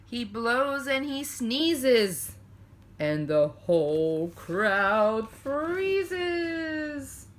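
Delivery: slow, lilting bedtime-story narration; cozy picture-book reading, stretched and sing-song; storytelling read-aloud. A narrator's reading. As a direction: read it to children at bedtime, drawing out the lines.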